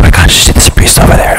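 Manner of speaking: mock whispering bystander. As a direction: whisper it behind your hand like a nosy onlooker. Onlookers gossip about a priest.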